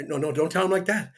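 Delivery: usual speaking voice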